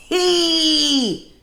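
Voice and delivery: loud, high, very slow gloat; drawn-out gleeful high shout. Real, not acted. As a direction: stretch it out in glee. Gleeful punchline.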